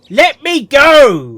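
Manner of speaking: slow, loud, drawn-out repeat; loud echo. Retold or imitated. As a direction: shout it back slowly. Listener echoes the shout.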